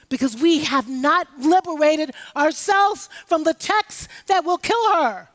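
rising to a loud shout on "that will kill her"; preached shout. Real, not acted. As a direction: build to a full-voiced cry. Preaching climax, shouted warning.

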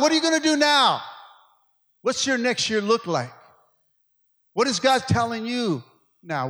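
loud, high preacher's call; fiery preaching. Acted. Pastor calling out those serving God, loud preaching. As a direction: call out the Christians in the house.

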